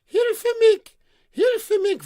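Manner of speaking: very high, very loud scream; shrill scream for help. Acted. The maiden screams from the top of the tower. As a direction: scream it as if trapped high above. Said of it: "the Mädchen was still screaming".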